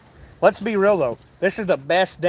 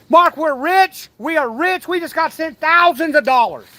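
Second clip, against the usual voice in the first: shrieking jackpot winner; 8 semitones higher than usual. Pretend. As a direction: squeal like you just won big. Joking about gift money.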